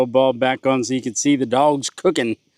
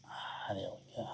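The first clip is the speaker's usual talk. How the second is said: whispered; 5 semitones lower, 23 dB quieter than usual. Real, not acted. Whispered while cooking.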